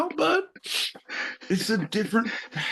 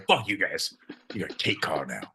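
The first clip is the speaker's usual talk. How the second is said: muttered; 13 semitones lower, 8 dB quieter than usual. Acted. Muttering while led away.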